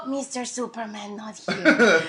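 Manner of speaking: lightly voiced coy waitress; mock waitress voice. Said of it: "Did she go".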